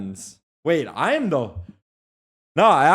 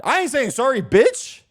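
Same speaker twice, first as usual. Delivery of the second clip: mock defiant yell; 13 semitones higher, 3 dB louder than usual. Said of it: "Just kidding, sorry".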